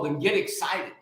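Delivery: ordinary delivery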